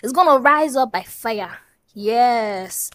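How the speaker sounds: half-joking pulpit declaration; mock prophecy. Blessing her viewers like a pastor, mock-preaching loud. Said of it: "I'm prophesying".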